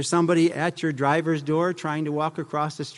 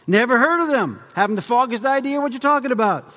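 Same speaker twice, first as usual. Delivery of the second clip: put-on southern accent; 7 semitones higher, 3 dB louder than usual. Mocking an ignorant country driver, in a higher, louder Southern accent. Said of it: "a southern accent for that".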